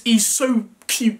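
usual speaking voice

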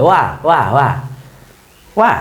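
"ওয়া" repeated as a short wa; W-glide pronunciation. Shown as a demonstration. Teacher drilling the sound for students, W-glide pronunciation. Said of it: "বাকিগুলো ওয়া হবে".

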